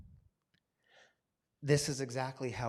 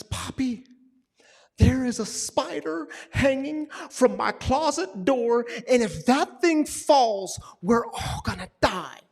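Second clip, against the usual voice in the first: put-on high, loud, panicked child voice; scared little daughter; 11 semitones higher, 12 dB louder than usual. Daughter crying to her dad about a spider, high panic. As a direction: play a terrified eight-year-old. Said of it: "She says".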